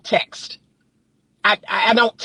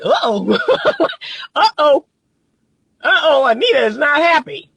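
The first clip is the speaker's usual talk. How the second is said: higher mock uh-oh, with laughter; worried man imitated; 5 semitones higher than usual. Man realizing the woman is unhappy, mock alarm. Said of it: "That's when a man is like".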